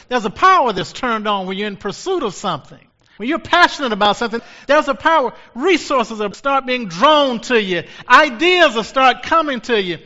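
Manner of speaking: high, loud sermon cry; fiery preacher rousing the congregation; preaching at full force. Acted. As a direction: drive it home like a revival preacher. Preaching builds again.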